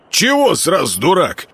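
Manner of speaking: offended. A narrator's reading. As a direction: take offence at the insult. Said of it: "обиделся Мельник".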